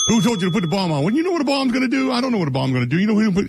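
high-pitched, rapid-fire sitcom impression; Jackie's Seinfeld voice. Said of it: "you gotta say it like that, like Jackie".

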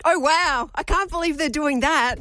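excited. Shown as a demonstration. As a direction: lift it up and sound thrilled. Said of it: "Okay, I'll be excited".

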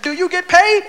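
high, pointed question in imitation; Richard Pryor. Older comic quizzes the upset younger comic, high impression. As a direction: raise the voice to drive the question home. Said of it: "He said".

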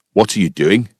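puzzled Scottish farmer challenging a stranger. Retold or imitated. Farmer asks the man praying in his field, Scottish accent. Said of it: "it's clearly a Scottish accent".

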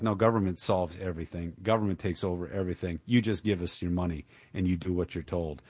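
mock them under your breath. Mocking politicians, low and quiet.

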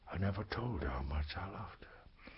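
breathy unvoiced whisper, barely there; grieving whisper. Storyteller mourning his teacher, in a whisper. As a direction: confess it in a whisper as if to yourself. Grieving confession of lost love.

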